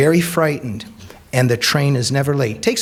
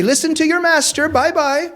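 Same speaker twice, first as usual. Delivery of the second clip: much higher, teasing send-off; teasing quip. Retold or imitated. Louis mocking the fleeing women, playful higher voice. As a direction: tease them cheerfully on the way out. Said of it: "who has a great sense of humor, will say".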